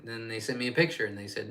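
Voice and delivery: ordinary talk, nothing marked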